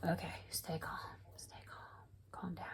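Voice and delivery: hushed whisper telling herself to calm down; talking herself down about a yarn problem; whispered self-calming. Retold or imitated. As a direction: soothe yourself under your breath. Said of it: "so I thought to myself".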